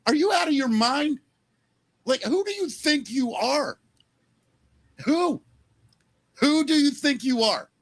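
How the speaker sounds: high, indignant outburst; father outraged at the idea, shouting in disbelief. Real, not acted. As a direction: rail at them in disbelief. Outraged rant about daughter.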